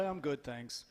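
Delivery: low, soft, unenthusiastic answer; mock the expected muted small talk; dutifully underwhelmed; subdued polite reply. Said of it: "'Cause you're supposed to go".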